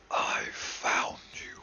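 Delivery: slow, breathy demon whisper; demonic whisper. Acted. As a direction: hiss it slowly like a demon. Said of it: "a demonic voice that says".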